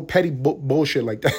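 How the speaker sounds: usual speaking voice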